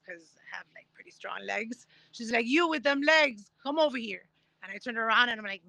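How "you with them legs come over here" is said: retold call to someone across a crowd. Retells woman calling across crowd.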